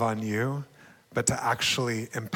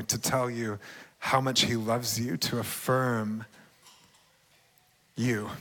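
ordinary delivery

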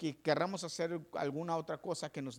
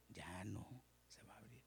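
much softer ominous whisper; 7 semitones lower, 17 dB quieter than usual. Acted. Pastor repeating that the door stays shut, in a soft whisper. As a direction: breathe the line out as a final warning. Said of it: "repeated as whisper".

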